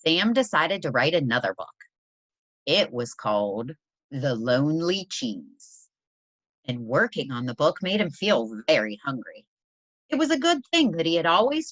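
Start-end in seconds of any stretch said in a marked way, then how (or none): none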